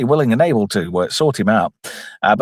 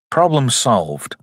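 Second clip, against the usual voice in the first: ordinary talk, nothing marked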